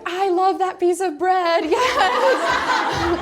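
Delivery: high, loud, drawn-out mock wail; hammed-up adoring outburst. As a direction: overcome with love, played for laughs. Acted tearful outburst at adoration.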